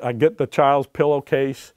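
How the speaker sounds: ordinary talk, nothing marked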